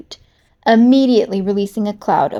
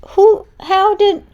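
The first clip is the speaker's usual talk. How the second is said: stunned disbelief; 11 semitones higher, 5 dB louder, slower than usual. Acted.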